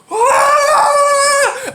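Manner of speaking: very high, drawn-out mock scream; imitated falling scream. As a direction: scream like someone flying over the handlebars. Imitates friend flipping off bike.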